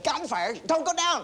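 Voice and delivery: high, panicked plea; alarmed wife. Retold or imitated. Wife begs husband not to go downstairs, high and panicked.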